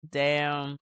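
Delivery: ordinary talk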